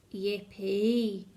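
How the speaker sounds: low, odd little cheer; a low mock whoop; lightly voiced cheer. A narrator's reading. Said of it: "the teas cried out".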